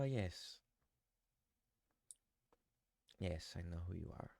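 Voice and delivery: a lightly voiced, soft old Archbishop. GM voicing the Archbishop leaving for his family home, soft and courteous. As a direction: play him quietly and courteously.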